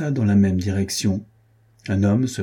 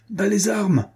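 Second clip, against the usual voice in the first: a much higher cry to stop the fight; gasping as he runs, yell at them to drop swords; breathless shout; 11 semitones higher than usual. A narrator's reading. Magnus running up to the duelists, breathless high shout. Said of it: "leur criait-il hors d'haleine".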